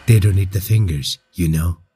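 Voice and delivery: low, menacing creature's line; malicious. A narrator's reading. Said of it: "he said maliciously".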